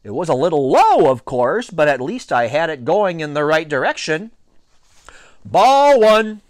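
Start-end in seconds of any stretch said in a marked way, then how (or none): 5.5-6.4 high, loud drawn-out call; umpires' shout. A narrator's reading. Two umpires calling the pitch, shouting. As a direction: bellow the count like an umpire. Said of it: "shouted both umpires together".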